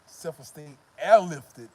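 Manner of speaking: loud, slow stress on airlifted; emphatic punchline. Real, not acted. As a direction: land the joke loud and drawn out. Mocking punchline.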